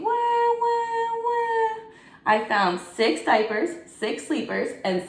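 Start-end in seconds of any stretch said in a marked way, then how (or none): 0.0-1.8 put-on high sing-song baby wails; playful high baby crying; crying babies. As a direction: wail like newborn babies.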